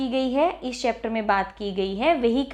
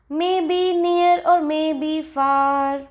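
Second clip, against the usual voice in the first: very slow, higher chanted line; stretched sing-song on a level pitch; poem recitation; 4 semitones higher, much slower than usual. Shown as a demonstration. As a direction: recite it like a nursery rhyme. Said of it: "so let us recite this".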